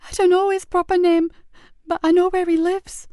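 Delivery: eager but scared; anxious boy. Acted. Boy telling the sergeant what he knows, high and anxious.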